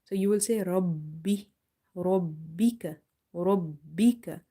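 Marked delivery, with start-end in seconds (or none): 2.0-4.4 Rabbika slowly with the b held twice; slow Rabbika, bb stressed; doubled b. Shown as a demonstration. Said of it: "pronounce it twice".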